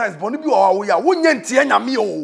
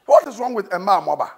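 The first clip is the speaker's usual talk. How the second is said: ordinary talk, nothing marked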